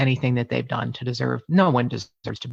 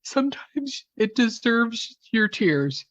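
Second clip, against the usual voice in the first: crying; 8 semitones higher than usual. Real, not acted. Host telling listeners she can't stop it, tearful. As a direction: push through real tears with a small laugh at yourself. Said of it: "As you can see".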